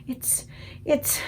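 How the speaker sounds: breathless voiceless whisper; a giant's awed whisper over a prize dream; thrilled whisper. Acted. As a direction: hush it out, too thrilled to speak. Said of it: "he whispered with a thrill in his voice".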